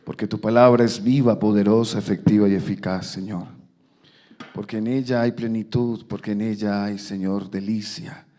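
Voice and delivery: loud, earnest pulpit prayer; fervent prayer. Real, not acted. Preacher praying to God before the sermon, fervent. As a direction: pray aloud with force, easing at phrase ends.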